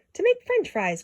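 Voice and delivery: excited outcry; cried out. A narrator's reading. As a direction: burst out with the idea. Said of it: "cried Jack".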